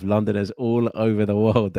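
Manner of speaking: ordinary delivery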